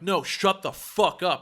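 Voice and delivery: retold forceful order. Pointing at the man on the phone, loud forceful order. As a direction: hit each word of the order firmly.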